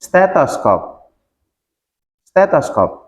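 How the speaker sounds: Stethoscope spoken slowly, every sound clear; model pronunciation. Shown as a demonstration. Teacher to students, asking them to repeat Stethoscope. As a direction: say Stethoscope carefully for the class to copy. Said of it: "Coba ikutin".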